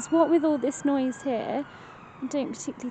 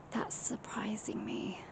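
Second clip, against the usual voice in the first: whispered surprise; 14 dB quieter than usual. Real, not acted. Device goes off unexpectedly.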